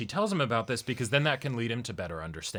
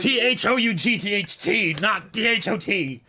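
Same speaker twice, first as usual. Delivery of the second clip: mock exasperated spelling; 8 semitones higher, 6 dB louder than usual. Real, not acted. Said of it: "God damn it".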